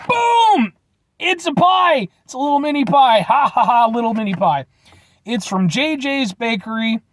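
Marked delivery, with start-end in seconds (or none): 0.1-2.1 excited shout. Acted. Revealing the gas station pie, excited shout.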